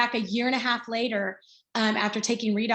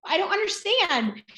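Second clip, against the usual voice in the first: high-pitched, fast, exasperated retold snap; snapped; 9 semitones higher, 3 dB louder than usual. Mother retelling how she snapped at her daughter, high irritated snap. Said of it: "I kinda snapped at her".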